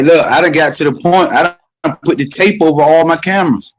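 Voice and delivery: ordinary delivery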